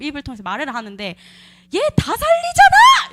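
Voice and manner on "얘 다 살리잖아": high, loud, drawn-out shriek; shrieking possessed outburst, much higher and louder; screaming. Retold or imitated. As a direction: yell it out as a possessed voice. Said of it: "제가 막 소리를 지르는 거죠".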